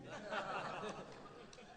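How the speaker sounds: a short high laugh at the memory; amused laugh. Real, not acted. Laughing at his own story.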